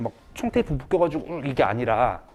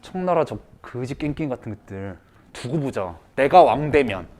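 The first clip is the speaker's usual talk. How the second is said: muttered grudge; 5 semitones lower, 4 dB quieter than usual. Retold or imitated. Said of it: "라는 마음가짐으로".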